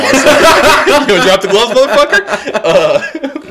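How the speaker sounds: high, slow, laughing cat taunt; hostile cat as a hockey goon. Pretend. The cat shaking its paws at him, a mock goon dare.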